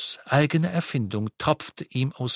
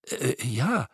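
confused Holly. A narrator's reading. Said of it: "sagte Holly verwirrt".

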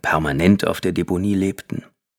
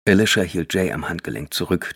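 usual speaking voice

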